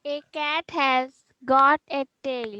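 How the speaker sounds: loud reading. Real, not acted. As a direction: read it out loud to the whole class. Pupil reading answer aloud.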